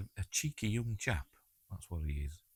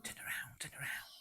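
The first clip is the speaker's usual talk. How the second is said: whispering; 12 dB quieter than usual. Pretend. Urging character on screen.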